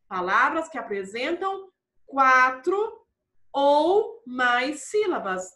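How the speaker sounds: ordinary delivery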